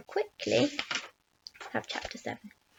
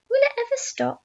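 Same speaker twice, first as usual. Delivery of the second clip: high-pitched amazed question; excited shout; 11 semitones higher, 4 dB louder than usual. A narrator's reading. Aunt dancing round the growing peach, high excited shout. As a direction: call out high in astonishment. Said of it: "Aunt Sponge shouted".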